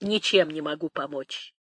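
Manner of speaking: low, soft admission; hushed, resigned helplessness; subdued refusal. Acted. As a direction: say it quietly, letting it fall. Nanny refuses help, subdued.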